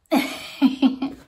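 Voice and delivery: rattling pig snorts. Shown as a demonstration. Teacher shows what else a pig can do, snorting. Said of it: "Or he can go".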